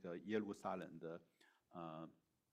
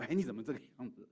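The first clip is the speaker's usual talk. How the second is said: quick, louder, higher mock reproach; pointed challenge; 4 semitones higher, 7 dB louder, much faster than usual. Bather points at the son in his underwear, mock reproach. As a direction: imitate someone calling out an oddity, fast. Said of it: "指他说".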